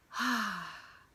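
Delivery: long loud voiced sigh out; demonstrated releasing sigh; audible sigh. Shown as a demonstration. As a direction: let the breath go with sound. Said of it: "let our breath out with a sigh and an audible".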